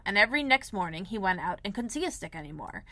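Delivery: ordinary delivery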